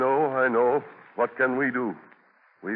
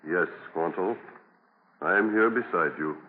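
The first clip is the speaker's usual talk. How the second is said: tender comfort; 7 semitones lower than usual. Acted. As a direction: soothe him gently. Governor comforts dying Squanto.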